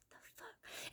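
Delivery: barely audible whisper; hushed, voiceless aside. Retold or imitated. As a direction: whisper it under your breath in disbelief. Muttered reaction in retold incident.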